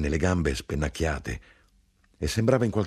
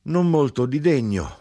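much higher and louder, mocking; grinder calling; 10 semitones higher, 6 dB louder than usual. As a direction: lift it into a scornful cry.

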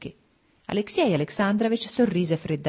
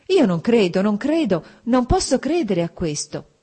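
louder, higher cry of denial; exclaiming in disbelief; 6 semitones higher, 5 dB louder than usual. A narrator's reading. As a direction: refuse to believe it, out loud. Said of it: "esclamò Dolly con un gesto energico". (esclamazione incredula, più forte e acuta)

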